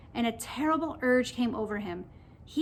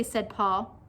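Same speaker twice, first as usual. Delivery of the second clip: ordinary delivery, nothing marked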